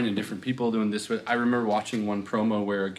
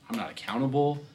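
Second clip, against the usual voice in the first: normal talking voice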